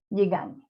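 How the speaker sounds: "je gagne" ending in a Spanish Ñ sound. Shown as a demonstration. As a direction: merge G and N in "je gagne" into ny. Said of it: "nos da el sonido".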